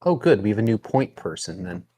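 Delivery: ordinary talk, nothing marked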